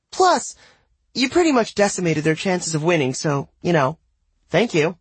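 put-on high, teasing girl's voice; grinning teen girl. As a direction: tease her with a twinkle.

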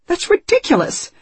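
high, loud hysterical outburst. A narrator's reading. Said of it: "a note of hysteria in her voice".

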